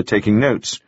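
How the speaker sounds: ordinary delivery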